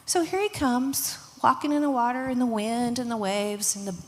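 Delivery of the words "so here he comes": plain reading voice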